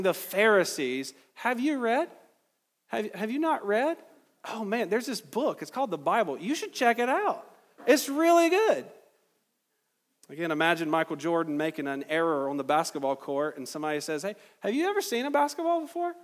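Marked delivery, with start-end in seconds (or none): 1.3-8.9 high, mock-helpful sarcasm; sarcastic. Preacher voices Jesus needling the Pharisees, sarcastic. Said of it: "when I see Jesus being sarcastic". 14.2-16.1 high-pitched mock-innocent question; stinging sarcasm. Someone needling Michael Jordan after an error, sarcastic. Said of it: "that kind of punch, that kind of sting".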